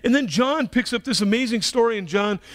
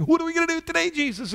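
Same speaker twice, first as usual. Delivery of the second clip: eager question; 10 semitones higher, faster than usual. Acted.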